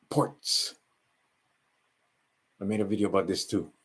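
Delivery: ordinary delivery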